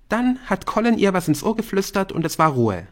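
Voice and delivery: put-on high indignant teen girl; huffy gossipy schoolgirl; Bryn voice. A narrator's reading.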